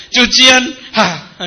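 hammed-up exasperation; mock complaining. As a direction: imitate someone sighing over chores. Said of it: "diselesaikan dengan ngeluh".

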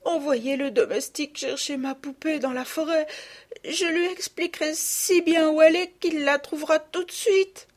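aiguë et lente, implorante; supplication d'enfant. Acted. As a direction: une fillette supplie sa maman. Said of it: "en joignant les mains". (supplique d'enfant aiguë et lente)

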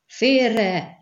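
high, loud, urgent shout; roared warning. A narrator's reading. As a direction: yell to get them out of the way. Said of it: "ordította Skellen".

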